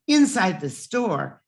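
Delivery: ordinary delivery, nothing marked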